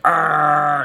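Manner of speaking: loud, barking growl; the three-headed dog growls loudly. Retold or imitated. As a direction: snarl like a guard dog. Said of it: "the dog's like".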